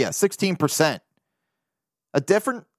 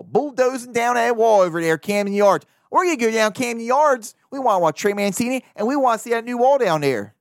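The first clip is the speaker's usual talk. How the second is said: Baltimore accent, pitched higher, hammed up; 7 semitones higher than usual. Podcaster clowning for fans as a Baltimore local, in a thick Baltimore accent. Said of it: "do a Baltimore accent".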